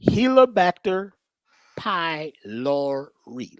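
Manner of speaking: Helicobacter pylori drawn out, pylori very slow; slowly spelled out. Shown as a demonstration. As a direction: stretch out Helicobacter pylori so they can look it up. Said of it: "Look him up".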